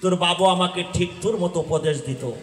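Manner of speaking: high, loud dramatic storytelling; preaching at full force. Acted. Preacher voicing the uncle and the child, high and loud. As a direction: cry out the story's dialogue to the crowd. Shouted exchange between story characters.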